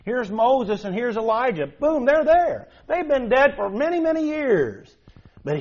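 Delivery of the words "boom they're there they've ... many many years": sudden excited exclamation. Real, not acted. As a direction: burst out with wonder at the sight.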